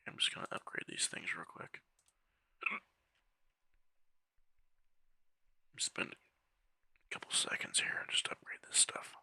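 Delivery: quiet muttered whisper. Real, not acted. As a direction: murmur it under breath. Muttering while upgrading.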